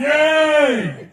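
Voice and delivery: loud high yay; cheer. Real, not acted. Speaker cheers as the crowd answers, loud and high.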